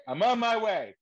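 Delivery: sudden loud high burst of eagerness; eager yes. Retold or imitated. Actor to agent, excited loud high burst. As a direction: jump at the offer, instantly thrilled.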